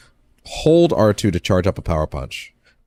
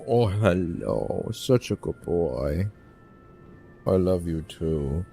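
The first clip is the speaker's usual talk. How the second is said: slow, tender pet talk; cooing affection; much slower than usual. Real, not acted. Streamer breaks off to dote on a good boy, slow cooing. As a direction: coo slowly to a beloved pet.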